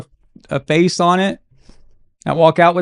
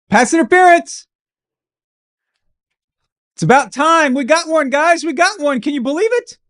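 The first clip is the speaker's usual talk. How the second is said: much higher, sarcastic cheer; mock excitement; 11 semitones higher than usual. Real, not acted. Fan to his viewers over a rare flag, much higher mock-excited cheer. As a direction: celebrate like it's a miracle, tongue in cheek.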